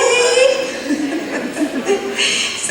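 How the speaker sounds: real laugh after the impression; high laugh. Real, not acted. Speaker laughing after the women's voices, a high laugh. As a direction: laugh at your own mimicry.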